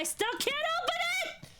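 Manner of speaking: very high, fast exasperated squeal; frustrated squeal. Real, not acted. Freezer still won't open.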